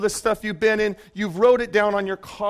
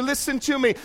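normal talking voice

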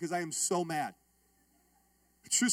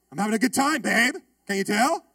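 louder sarcastic cheer; mock-cheerful line to his wife, clearly sulking; 7 dB louder than usual. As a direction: make it obvious you're not having fun.

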